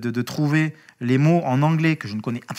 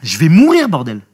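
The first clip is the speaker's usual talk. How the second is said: much louder, higher desperate yell; desperate shout; 4 semitones higher, 13 dB louder than usual. Retold or imitated. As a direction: scream that you're dying. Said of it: "je lui dis".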